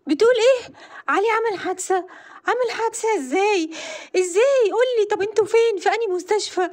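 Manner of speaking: a mother desperate for news of her son; frantic panic. Acted. Mother on the phone hearing of her son's accident, frantic and panicked.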